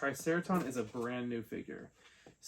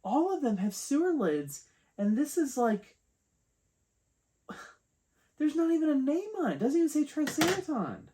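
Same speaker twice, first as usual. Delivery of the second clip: high-pitched, loud indignant complaint; exasperated disbelief; 13 semitones higher, 5 dB louder than usual. Real, not acted. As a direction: pitch it way up, incredulous at the shoddy product. Exasperated complaint about missing name.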